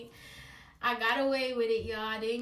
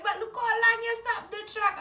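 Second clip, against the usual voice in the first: higher, louder skeptical mother; skeptical mom; 10 semitones higher, 6 dB louder than usual. Retold or imitated. Mother doubting the track excuse, higher skeptical voice. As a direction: poke a hole in the excuse. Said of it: "She was like".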